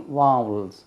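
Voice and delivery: वावल्स with an open aa, not वॉवल्स; firm teacher's correction on वावल्स; corrected pronunciation. Shown as a demonstration. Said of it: "वो वॉवल्स नहीं होती".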